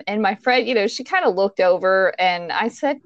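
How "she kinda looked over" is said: ordinary talk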